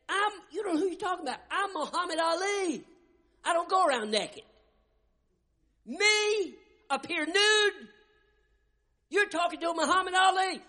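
high, loud, proud indignation, slowing on "Me appear nude?"; boastful Ali. Retold or imitated. Preacher playing Ali, refusing producers with high loud pride. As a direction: puff up like the champ refusing.

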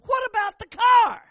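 high loud demand; demanding wife. Retold or imitated. Wife demanding of husband about the car, loud and high. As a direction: call it out high and sharp.

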